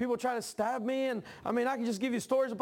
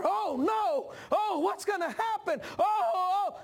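mock panic; 9 semitones higher, slower than usual. Voicing the panic of fear.

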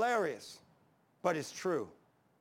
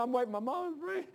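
retold crying; 7 semitones higher than usual. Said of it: "And he's crying".

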